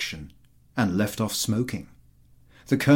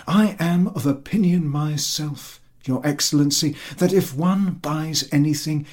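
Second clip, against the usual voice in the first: lightly voiced fawning landowner, higher; eager flatterer; 5 semitones higher, 5 dB louder than usual. A narrator's reading. Chertakutski flattering the general about his horse, eager and higher. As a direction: fawn over the horse in a raised, eager voice.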